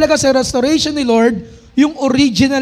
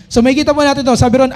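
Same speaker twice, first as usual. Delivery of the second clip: ordinary delivery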